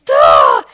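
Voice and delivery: loud, low burst of rage; angry outburst noise. Retold or imitated. Anger rising up in a cheated customer, low loud outburst. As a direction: let a sudden low growl erupt. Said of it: "something rose up in me".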